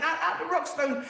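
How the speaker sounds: normal talking voice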